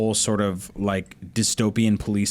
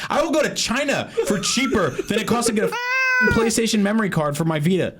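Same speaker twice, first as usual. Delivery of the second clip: angry yelling; 10 semitones higher than usual. Real, not acted. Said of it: "the thing I just yelled".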